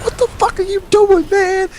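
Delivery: mock panicked kid, way up high; high squeaky outraged kid. Kid rubbed at lunch, squealing high in protest. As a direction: squeal it high like a freaked-out boy. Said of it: "He's like".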